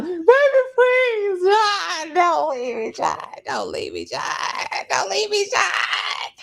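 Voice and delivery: hammed-up wailing plea, high and slow; mock begging wife. Host mocks wife begging husband, hammed-up wail. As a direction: overact a wife begging on her knees. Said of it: "When Carolyn got down".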